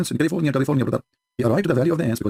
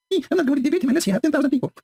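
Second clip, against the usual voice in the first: much higher, mocking whiny voice; mocking quote; 12 semitones higher than usual. Mocking a debate opponent's excuse, high and whiny. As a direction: parody the excuse in a whine.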